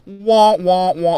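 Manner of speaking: mock crying. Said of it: "she's like".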